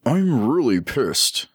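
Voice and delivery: mildly acted exasperation; annoyed. A narrator's reading. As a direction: draw out the irritation. Angry officer at police standoff.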